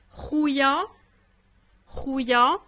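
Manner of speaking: rouya clearly stressed, the ya ending pronounced; model word. Shown as a demonstration. Teacher modelling rouya for a student, emphatic. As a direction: say rouya for the learner. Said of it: "avec un exemple".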